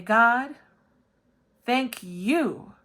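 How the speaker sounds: loud, high cry up to God; dramatic prayer. Acted. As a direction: call out to God in mock devotion.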